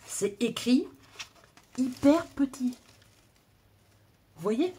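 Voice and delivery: loud, high and indignant; outraged complaint. Real, not acted. To her viewers, about the tiny print: loud indignant complaint. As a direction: protest like it's a scandal.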